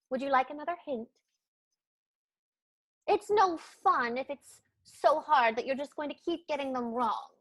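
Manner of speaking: ordinary delivery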